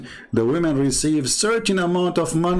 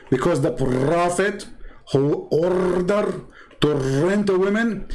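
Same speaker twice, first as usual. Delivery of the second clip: usual speaking voice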